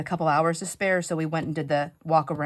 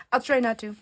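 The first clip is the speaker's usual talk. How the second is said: higher, amused retake of "I'll try not to"; laughing repeat; 6 semitones higher than usual. Real, not acted. Promising viewers not to repeat stories, amused.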